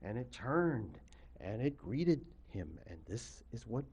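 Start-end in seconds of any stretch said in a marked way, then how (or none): none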